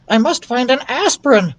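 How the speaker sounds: high, hurried mouse voice; determined small mouse; urgent mouse. Acted. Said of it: "thought Ralph".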